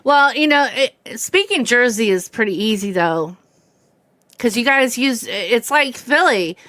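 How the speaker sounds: normal talking voice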